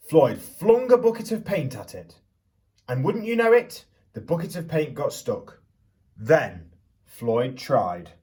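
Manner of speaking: put-on lively storyteller voice for children; picture book read-aloud. A narrator's reading. Teacher reading Stuck to the class, storytelling voice.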